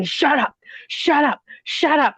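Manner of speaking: high, sharp mock yell of an old man, same loudness; retold shout. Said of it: "he's screaming at me to shut up".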